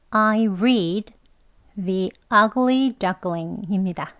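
The Ugly Duckling very slowly, with the stress on ugly; slow reading. Shown as a demonstration. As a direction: read it out slowly, leaning on ugly. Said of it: "예문은요". (천천히 또박또박)

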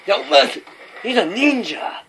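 high, loud excited yell; excited shout. Real, not acted. Gamer shouting about a ninja enemy, high excited yell. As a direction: yell it in high delighted panic.

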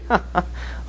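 quiet breathy chuckle; sly chuckle. Retold or imitated. Shopkeeper spying on Winston, quiet sly chuckle. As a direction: chuckle softly to yourself like a spy. Charrington's laugh while spying.

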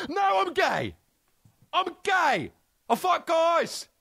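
high, petulant refusal; mock fussy star. Comic imagines the star refusing what the crew offers, high and petulant. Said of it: "could have been like".

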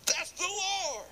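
high, slow, awestruck; amazed recognition. Acted. John recognizes Jesus.

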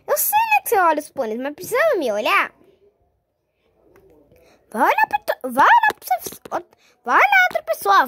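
high, loud irritated telling-off; high annoyed scolding. Acted. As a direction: shoo the starer away in a huff. Scolds staring friend.

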